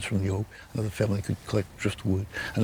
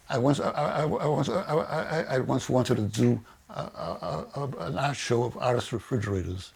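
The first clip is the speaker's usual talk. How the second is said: stuttering, repeating the same word; 3 semitones higher than usual. Real, not acted. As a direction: stammer on the first words, then push on. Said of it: "I'm aware that I'm stuttering".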